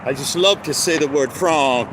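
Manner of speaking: ordinary talk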